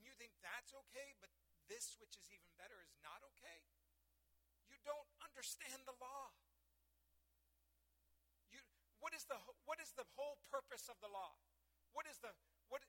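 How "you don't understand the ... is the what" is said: louder, higher challenge to the crowd; preaching with force. Acted. Preacher challenging the congregation, forceful preaching. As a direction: press the question home.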